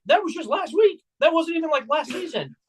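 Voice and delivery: high-pitched retold disbelief; incredulous retort. Retold incredulous retort to rival fans.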